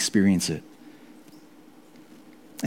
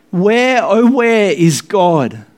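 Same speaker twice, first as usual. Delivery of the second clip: high, loud, slow wail; 9 semitones higher, 5 dB louder, much slower than usual. A narrator's reading. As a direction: wail it into the night, trembling. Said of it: "wailed into the nebulous night".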